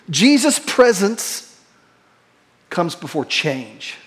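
slow, the end dropping low and soft; weighty preacher's close; slow emphatic point. Real, not acted. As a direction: land the main point slowly. Key sermon point.

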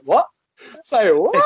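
incredulous, rising "what"; mock disbelief. Host voicing a friend's baffled face, in mock disbelief. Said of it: "Charlie's face is like".

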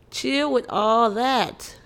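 slow, drawn-out chill with all that; a slow, weary chill with all that; slow plea. Shown as a demonstration. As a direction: stretch every word to make them stop. Repeats the plea slower.

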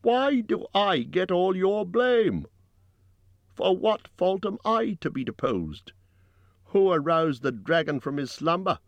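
high, declaiming orator; indignant public appeal, pitched high; loud and clear. A narrator's reading. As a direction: raise the voice high to challenge a crowd. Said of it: "spoke very loud and clear".

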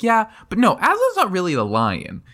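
high-pitched mock explaining voice; know-it-all dismissal. Bree insists Aslan is no lion, mock know-it-all. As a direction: pipe up as the horse who knows better.

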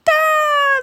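long, loud, very high scream; acted group scream. A narrator's reading. All three flee the bone, acted terrified scream. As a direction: scream it out in terror. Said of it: "they all screamed again".

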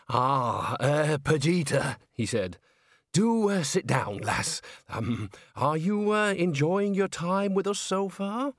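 halting, slow, hesitant voice; nervous manager. Acted. Bucket addresses Agnes, nervously halting. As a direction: stall with ums and uhs.

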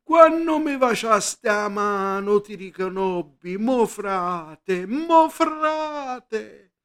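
high, slow, wailing Sicilian cry; shouting puppeteer. Acted. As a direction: call it from behind, haunting and high. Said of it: "il puparo che gridava". (il puparo grida il suo lamento)

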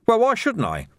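high-pitched, defensive retort. A narrator's reading. Commander justifies changing things to monks, defensive. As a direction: throw it back, pitch jumping up. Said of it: "added defensively".

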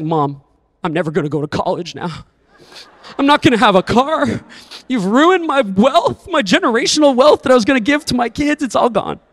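mock ten-year-old mourning his money to mom; dramatic kid. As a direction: overplay a ten-year-old's despair. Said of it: "very dramatic ten-year-old kid".

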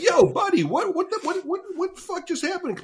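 shocked and indignant, asking what just happened; stunned disbelief. Retold or imitated. Shouting after the shop owner who just walked away in shock.

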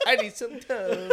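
squeaky laughing "I need some Tums"; mock it through laughter. Mocking friend's line, laughing.

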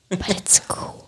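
whisper. Real, not acted. Whispered aside after ASMR.

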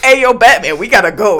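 loud high mock call. Coworker calling over to Batman in the hole, loud and high. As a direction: yell it across the dig site. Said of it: "And I'm like".

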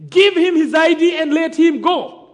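loud, high commanding shout; shouted order. Retold or imitated. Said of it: "he shouted".

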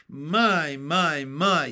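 loud, amazed exclamation; a doctor marvelling at his tidy garage; delighted cry. Acted. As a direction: cry it out in happy surprise. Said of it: "he cried".

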